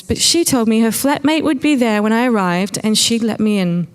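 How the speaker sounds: plain reading voice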